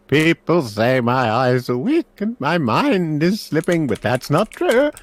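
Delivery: put-on high, slow, loud old man voice; doddering old magister, proud and high-pitched; old professor, pleased. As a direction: insist slowly in a high elderly voice.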